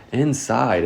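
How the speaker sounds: usual speaking voice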